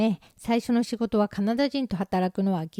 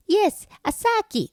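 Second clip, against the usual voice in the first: high, slow emphatic English answer; acted emphatic confirmation, much higher; customer's reply; 11 semitones higher, slower than usual.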